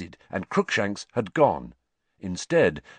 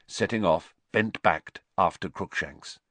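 usual speaking voice

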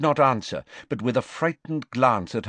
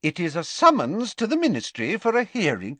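formal, higher official voice; lightly voiced official; 8 semitones higher than usual. Official reading out a scroll to the father, formal. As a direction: announce the summons formally.

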